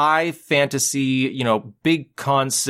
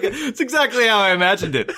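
higher, giggly smug satisfaction; laughing mock Katzenberg; 9 semitones higher than usual. Katzenberg in the booth, delighted and laughing. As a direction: crack up while sounding pleased. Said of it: "He's like".

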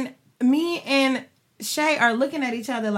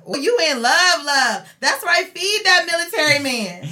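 teasing fan voice; 6 semitones higher, 9 dB louder than usual. Retold or imitated. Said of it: "hitting my D M that listens to the podcast".

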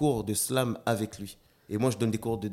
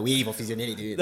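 Quito impression; 4 dB louder than usual. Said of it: "Quito, s'il était là, il va faire".

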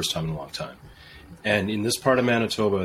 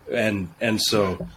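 ordinary talk, nothing marked

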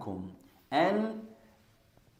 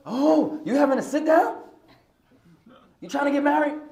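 high, louder teasing wife voice; mock suspicious wife; 10 semitones higher, 5 dB louder than usual. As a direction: tease him like a suspicious wife. Wife teasing husband about oil.

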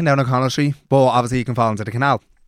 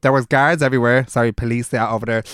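normal talking voice

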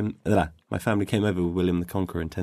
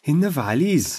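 higher, sing-song Welsh accent; 7 semitones higher, slower than usual. Shown as a demonstration. Guest answering the host's accent test, high Welsh accent. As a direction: put on a lilting Welsh voice. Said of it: "try and do a Welsh accent".

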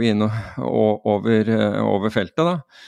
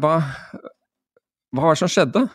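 high-pitched retold bafflement; acted puzzled questioning; baffled question; 6 semitones higher than usual. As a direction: ask it as if thrown. Baffled exchange with student, retold.

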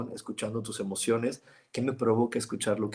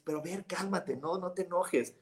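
higher, softer placating voice, like calming an upset person; soothing someone angry; 7 semitones higher, 6 dB quieter than usual. Retold or imitated. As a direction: coax them gently to calm down, a bit higher and softer. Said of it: "y tú le dices". (voz alta y suave, tratando de calmar)

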